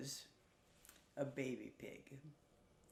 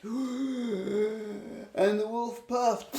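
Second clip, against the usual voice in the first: huffing puff; 5 semitones higher, 5 dB louder, much slower than usual. Acted. Wolf blowing.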